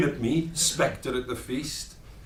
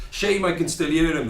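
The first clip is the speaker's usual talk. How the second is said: ordinary talk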